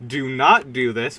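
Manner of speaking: ordinary talk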